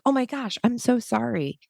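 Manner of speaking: high, startled and apologetic; flustered apology. Retold or imitated. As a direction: blurt out a panicked sorry. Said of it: "I was like".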